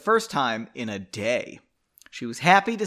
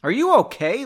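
higher, louder worried question; concerned call; 8 semitones higher, 7 dB louder than usual. Acted. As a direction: call out as you run up. Leonid calls out arriving.

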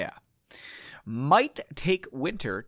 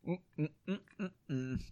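plain reading voice

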